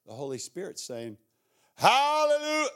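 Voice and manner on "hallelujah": high, loud hallelujah cry; joyful shout. Acted. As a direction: burst out in praise, much higher. Holy Spirit shouting praise.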